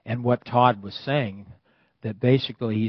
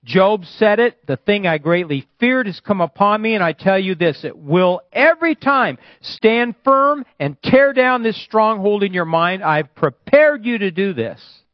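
ringing, stretched-out sermon delivery; prophetic preaching; 10 semitones higher, 8 dB louder than usual. Real, not acted. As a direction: proclaim it with full authority. Declaims prophecy at full force.

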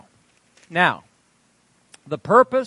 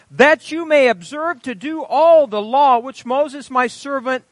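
raised, ringing Bible reading; scripture reading; 6 semitones higher, 5 dB louder than usual. Acted. Preacher reading Joshua to the congregation, ringing proclamation. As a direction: proclaim the verses with weight.

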